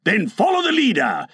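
rally them gaily. A narrator's reading. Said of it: "he cried gaily".

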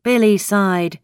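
plain reading voice

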